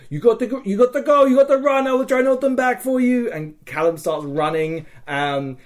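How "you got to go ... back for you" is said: rising to a loud, higher-pitched yell at a friend; urgent shout. Retold or imitated. Telling Callum to run while he holds the enemy back, urgent shout. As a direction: yell at him to run while you hold them off. Urging Callum to flee battle.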